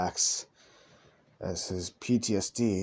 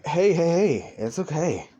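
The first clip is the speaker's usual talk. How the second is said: startled reassurance; 7 semitones higher, 5 dB louder than usual. Acted. Friend calming a flinching friend.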